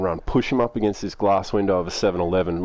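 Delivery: ordinary talk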